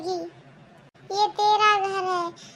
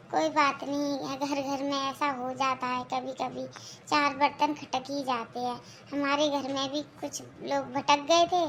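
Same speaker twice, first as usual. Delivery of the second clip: lower, softer son comforting his mother; son's voice; 6 semitones lower, 5 dB quieter than usual. Acted. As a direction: gently reassure your mother.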